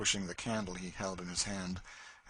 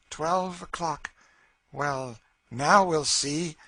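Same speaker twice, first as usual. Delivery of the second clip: slow and drawn out, higher; ominous slow Cassie; 7 semitones higher, 8 dB louder, much slower than usual. A narrator's reading. Cassie counts midnight to unnerve Legree, slow and ominous. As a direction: let each word hang like a clock stroke.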